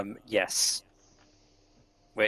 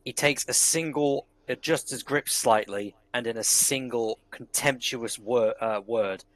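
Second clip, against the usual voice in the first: ordinary talk, nothing marked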